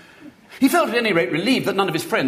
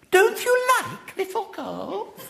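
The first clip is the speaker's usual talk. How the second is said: put-on high, slow childish lisp; lisping little girl; slower than usual. A narrator's reading. Little girl asks William's name, lisping high and slow. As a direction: lisp it like a spoilt small girl.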